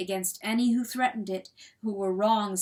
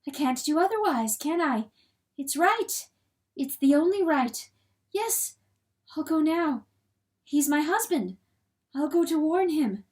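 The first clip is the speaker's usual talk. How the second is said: higher voice, brief firm phrases; resolved hen; 7 semitones higher, 3 dB louder than usual. A narrator's reading. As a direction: talk yourself into going, pitched up. Hen resolves to warn her husband.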